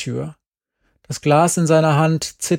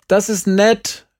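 louder, higher, slow polite call; calling back; 7 semitones higher, 5 dB louder, slower than usual. A narrator's reading. Girl calls back politely to the old man, louder and slower. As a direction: call it back over your shoulder. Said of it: "rief ich zurück".

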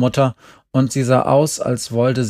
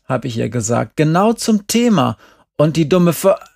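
high-pitched, animated self-justification; heated protest; 6 semitones higher, 4 dB louder than usual. A narrator's reading. Jerry recounts explaining the painting to the teacher, heated. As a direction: argue your case with rising exasperation.

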